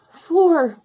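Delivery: high, loud gasp of alarm. A narrator's reading. Tufty to the other foxes after counting only four, gasped. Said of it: "gasped Tufty".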